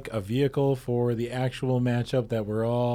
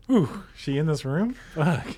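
ordinary talk, nothing marked

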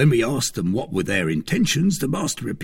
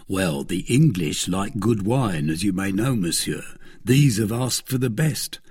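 usual speaking voice

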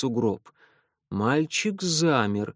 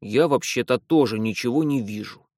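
grumbling. A narrator's reading. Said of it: "буркнул Патрик".

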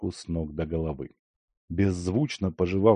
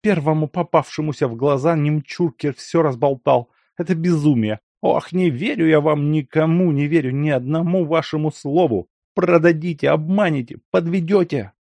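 high, angry and distrustful; angry high rant; 8 semitones higher, 5 dB louder than usual. A narrator's reading. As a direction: spit the accusations out. Lyakhovsky rages at them.

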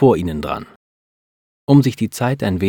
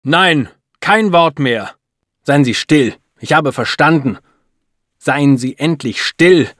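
loud, higher, angry interruption; furious; 6 semitones higher, 6 dB louder than usual. A narrator's reading. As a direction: cut her off in raised-voice fury. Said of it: "Er ist wütend".

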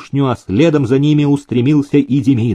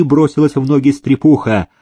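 ordinary delivery

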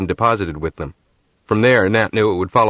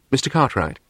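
put-on crisp English accent; 5 semitones higher than usual. A narrator's reading. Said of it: "The same crisp English accent".

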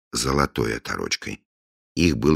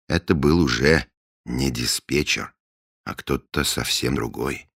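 usual speaking voice

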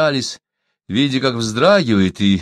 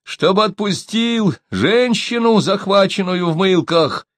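high, slow, deliberate call; storyteller voicing a shouted message; shouting across; 10 semitones higher, slower than usual. A narrator's reading. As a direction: call each phrase out long and high. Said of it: "крикнул Диан по-китайски".

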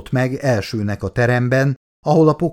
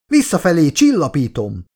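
higher-pitched hurried-sounding cry; frantic shout; 6 semitones higher, 3 dB louder than usual. A narrator's reading. Colleague answers the shrieking doctor, frantic cry. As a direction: call back in alarm, pitch raised.

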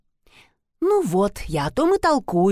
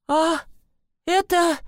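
startled stammer; 9 semitones higher, slower than usual. Acted.

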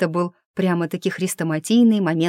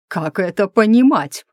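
louder, indignant question with a rising stress; 2 semitones higher, 6 dB louder than usual. A narrator's reading. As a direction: demand an explanation, mildly affronted. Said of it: "возмутился преподобный". (возмущённо)

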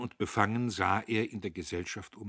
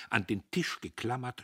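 usual speaking voice

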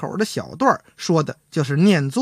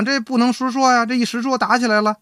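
ordinary delivery

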